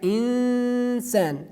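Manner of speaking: slow insan, nasal n held two counts; held ghunna. Shown as a demonstration. Teacher showing ghunna two letters long. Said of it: "we pronounce it like equal to two letters".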